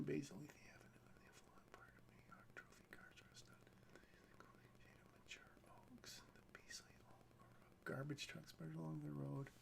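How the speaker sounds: script read in a soft whisper. Acted. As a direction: breathe the scene text out, fast and voiceless. Reads the script's scene lines.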